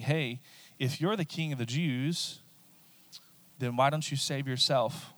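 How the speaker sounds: ordinary talk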